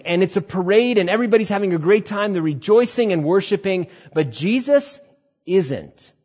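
ordinary delivery

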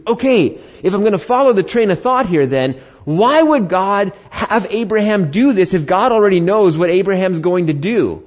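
skeptical objector. Pretend. Pastor voicing a skeptic in the congregation, puzzled objection. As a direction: play the doubter pushing back.

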